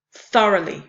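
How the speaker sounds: "thoroughly" strong on THOR, then two falling weak syllables; dactyl stress. Shown as a demonstration. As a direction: punch the first syllable of "thoroughly". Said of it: "stressed syllable followed by two unstressed".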